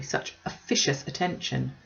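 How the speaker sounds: usual speaking voice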